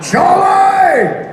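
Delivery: long high shout of a name; full-voiced yell across a distance; calling out. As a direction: holler it, drawn out and high. Calling out to a pilot in a story.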